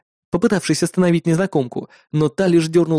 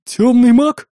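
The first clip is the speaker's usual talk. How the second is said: high, loud fearful question; frightened; 8 semitones higher, 5 dB louder, slower than usual. A narrator's reading. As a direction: ask in sudden fright, rising on маг. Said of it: "испуганно спросила трактирщица". (испуганно)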